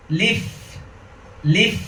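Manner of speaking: "লিফ" with the final f held long; high "লিফ", sustained f at the end. Shown as a demonstration. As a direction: let the f of "লিফ" hiss on. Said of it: "ফাই ধরে রাখতে হবে".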